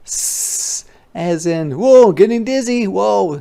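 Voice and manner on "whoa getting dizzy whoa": dizzy whoa. Acted. Dizzy on swing set.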